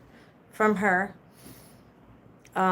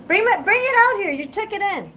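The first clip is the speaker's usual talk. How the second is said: raised, much higher-pitched call; 11 semitones higher, 5 dB louder than usual. Real, not acted. Streamer telling a helper to bring it out, calling out high. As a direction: call across to someone to fetch something.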